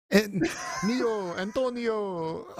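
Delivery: high, drawn-out mock Italian name; attempted French that comes out Italian; Italian-sounding accent. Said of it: "That was more Italian".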